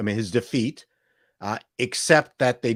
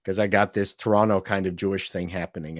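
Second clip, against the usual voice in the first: usual speaking voice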